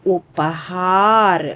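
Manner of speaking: ఉపహార్ drawn out very slowly; slow model word. Shown as a demonstration. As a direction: stretch ఉపహార్ so kids can copy. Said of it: "పలకండమ్మా".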